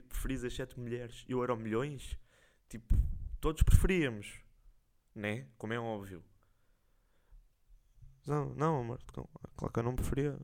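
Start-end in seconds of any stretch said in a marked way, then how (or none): none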